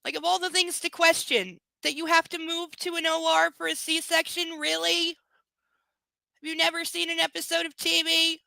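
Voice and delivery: loud and incredulous; incredulous rant. Real, not acted. Reader rants at the story.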